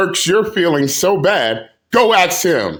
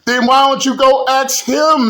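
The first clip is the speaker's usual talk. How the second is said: higher, louder, pushing back; heated retort; 7 semitones higher, 3 dB louder than usual. Real, not acted. Creator retorting to a rival's clip, raised and heated. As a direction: throw the question back at her.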